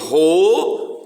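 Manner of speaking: RO held long and alone; isolated syllable. Shown as a demonstration. Teacher marking the first piece of Romeu, drawn-out syllable. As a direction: stretch RO as a separate piece. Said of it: "primeiro pedaço".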